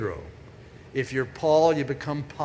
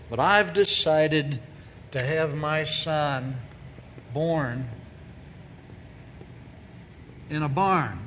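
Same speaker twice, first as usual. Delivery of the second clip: slow, with a long pause before each phrase; slow dramatic pauses; slower than usual. Acted. God answering Gail, slow with comic pauses. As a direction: hold back each phrase, then drop the punchline. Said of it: "He says".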